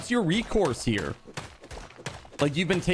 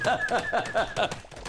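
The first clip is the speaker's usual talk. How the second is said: laugh; 5 semitones higher than usual. Real, not acted. Laughing at own joke.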